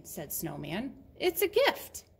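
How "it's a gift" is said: high, louder storybook exclamation; lightly acted excited reveal; cheery announcement. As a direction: announce the present brightly. Snowman announces gift in story.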